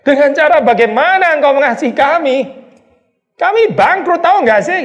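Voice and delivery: high, loud exasperated retort; mock-outraged people protesting they're broke; indignant mock protest. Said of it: "Tetapi kamu berkata".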